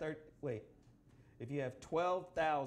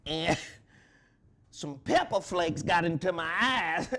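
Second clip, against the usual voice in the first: wrestling promo; 7 semitones higher, 6 dB louder than usual. Acted. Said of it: "it's a promo".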